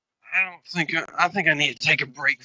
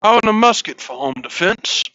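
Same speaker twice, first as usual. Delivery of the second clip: mock colonial bravado; 4 semitones higher, 9 dB louder than usual. Wearing powdered wig, playing colonial.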